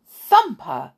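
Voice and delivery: a firm telling-off; scolding. Acted. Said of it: "his mother scolded".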